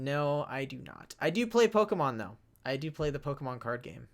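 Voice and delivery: ordinary talk, nothing marked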